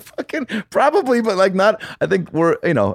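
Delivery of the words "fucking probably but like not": much higher, disbelieving squeak; pitched way up in half-laughing disbelief; incredulous. Retold or imitated. Incredulous reply to Winkler.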